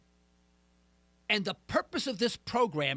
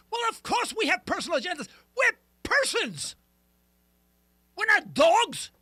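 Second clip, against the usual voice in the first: higher-pitched, exasperated comeback; indignant retort; 6 semitones higher, 3 dB louder than usual. Acted. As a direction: push back as if stating the obvious. Retorts to the rioters' critics.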